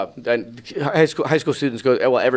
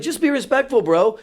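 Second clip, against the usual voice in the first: indignant telling-off; 11 semitones higher than usual. Retold or imitated. Retold telling-off of heckler.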